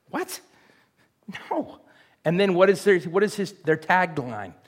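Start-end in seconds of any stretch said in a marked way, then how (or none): 0.1-1.8 high, soft incredulous question and refusal; Jesus, taken aback. Acted. Answering disciples who want fire from heaven, high-pitched disbelief. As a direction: squeak out mock disbelief, pausing before the refusal.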